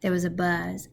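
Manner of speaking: ordinary delivery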